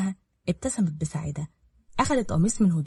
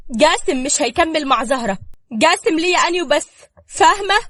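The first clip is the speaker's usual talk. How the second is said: high, loud jealous claim; possessive outburst; 9 semitones higher, 8 dB louder than usual. A narrator's reading. Ruqaya fiercely tells Safaa Jasim is hers alone. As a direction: stake your claim, fiercely.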